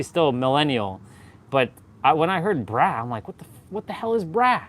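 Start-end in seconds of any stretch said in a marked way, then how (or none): 3.3-4.6 high-pitched mock bewilderment; baffled disbelief. On first hearing "brah", baffled. Retold reaction to new slang.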